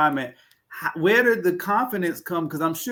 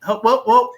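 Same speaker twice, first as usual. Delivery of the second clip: mock alarm whoop; 6 semitones higher, 6 dB louder than usual. Playful alarm when something is deep.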